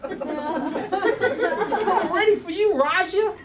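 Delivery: high-pitched Brooklyn shopkeeper impression; Brooklyn accent. Bagel shop owner taking an order, Brooklyn accent. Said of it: "saying Roger with a Brooklyn accent".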